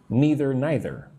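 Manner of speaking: first neither as NEE-ther, second neither as NYE-ther; neither with EE, then neither with EYE; two pronunciations. Shown as a demonstration. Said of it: "Both are okay".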